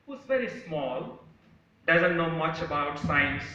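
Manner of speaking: usual speaking voice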